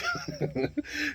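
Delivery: short high chuckle; quick, light laugh. Real, not acted. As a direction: giggle briefly at your own joke. Laughs after his pep talk.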